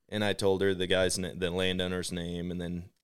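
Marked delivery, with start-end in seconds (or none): none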